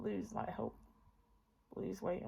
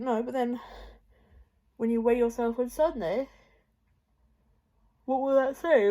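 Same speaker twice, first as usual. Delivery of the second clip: loud; 4 semitones higher, 7 dB louder than usual. Retold or imitated. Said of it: "I was thinking".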